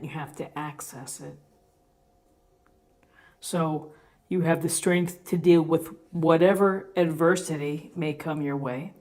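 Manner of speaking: ordinary delivery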